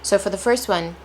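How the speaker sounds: usual speaking voice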